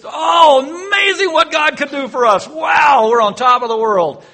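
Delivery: loud, high shout of joy; high, loud victory whoop; jubilant cheer. Acted. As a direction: cheer like a crowd that just won. Israel cheering after the sea.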